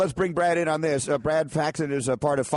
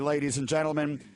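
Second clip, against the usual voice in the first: ordinary talk, nothing marked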